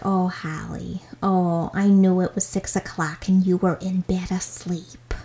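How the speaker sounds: soft, whispery Midwestern grandma; Midwestern grandmother accent. Retold or imitated. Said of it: "my other grandmother's Midwestern".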